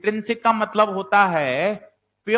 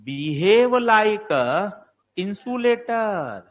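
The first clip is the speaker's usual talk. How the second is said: slow dictation; much slower than usual. Shown as a demonstration. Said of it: "दो बार सवाल पूछा गया है".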